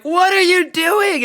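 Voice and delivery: imitating a drawn-out, high-pitched shriek; mock shocked shriek. Girl to the kid rubbing her leg, mock shocked shriek. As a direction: shriek it slow, loud and way up high, hammed-up. Retold girl confronts crawling classmate.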